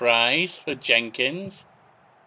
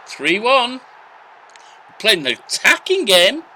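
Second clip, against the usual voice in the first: excited; 11 semitones higher, 8 dB louder, slower than usual. Real, not acted. Cheering a goal going in.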